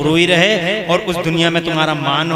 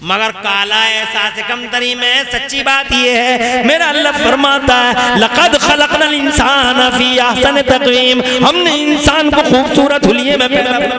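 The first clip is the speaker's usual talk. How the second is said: raised, high-pitched preaching voice; fervent preaching; 6 semitones higher, 6 dB louder than usual. Real, not acted. Preacher to crowd on Allah creating humans beautiful, fervent preaching. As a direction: build into loud, high proclamation.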